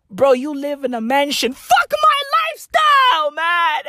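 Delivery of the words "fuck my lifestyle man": high wailing squeal breaking into a laugh; mock whiny wail. Comic mocking a billionaire, wailing into laughter. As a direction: wail it like a spoiled child.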